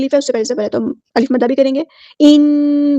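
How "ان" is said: ان held in the nose; ان, doubled noon hummed through the nose; ghunna. Shown as a demonstration. Teacher models ghunna for students, nasal hum. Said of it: "یہ گنہ کر لیا".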